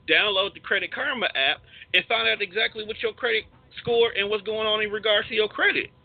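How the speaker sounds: normal talking voice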